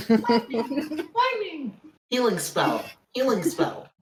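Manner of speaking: high, loud repeated yells; frantic yelling. Retold or imitated. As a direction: spam the spell name, laughing. Mocking friend's spam casting.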